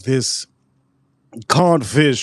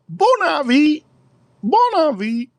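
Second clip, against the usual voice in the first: high, put-on French greeting; manager's mock French welcome, pitched high; 13 semitones higher, slower than usual. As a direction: play the French bakery manager saying hello. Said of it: "and the manager".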